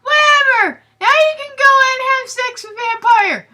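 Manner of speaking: high, loud bratty yell; mock angry shout. Ebony yells at Draco, high bratty shout. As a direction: scream it like a teen storming off. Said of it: "I shouted".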